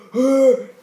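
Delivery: loud, high-pitched gasp; imitated fight gasp. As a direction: suck in a big high gasp mid-fight. Acts out the fight blows.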